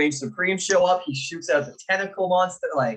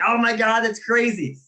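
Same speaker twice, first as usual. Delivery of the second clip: higher-pitched mock amazement; excited reaction; 6 semitones higher than usual. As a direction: retell the crowd's awed reaction. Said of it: "we're all like".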